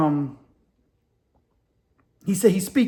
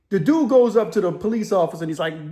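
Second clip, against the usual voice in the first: ordinary delivery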